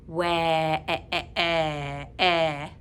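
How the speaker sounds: ordinary talk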